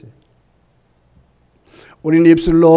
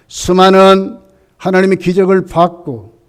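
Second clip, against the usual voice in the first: ordinary delivery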